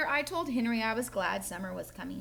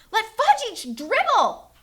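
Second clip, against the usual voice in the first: much higher and louder, slow scream; 11 semitones higher, 11 dB louder, slower than usual. Acted. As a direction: scream it at Mom in outrage. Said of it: "I screamed".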